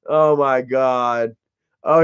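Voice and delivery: ordinary talk, nothing marked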